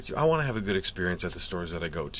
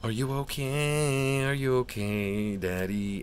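put-on tender baby talk; 5 semitones higher, 4 dB louder than usual. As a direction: coo like to a child. Fussing over pulled card.

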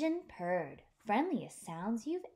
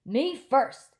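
loud, proud me first; puffed with pride; 4 semitones higher, 9 dB louder than usual. Acted. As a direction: puff up and demand the front. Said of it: "all puffed with pride".

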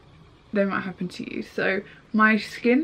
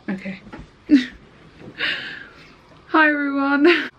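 ordinary delivery

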